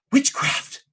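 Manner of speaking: high, startled "Witchcraft"; a scared maid shrinking back; gasped accusation. Acted. Maid recoiling from the telepathic cleric, high and startled.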